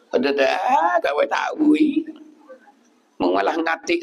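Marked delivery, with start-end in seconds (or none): 0.3-2.0 mock high protest. Acting out someone's reply to a request.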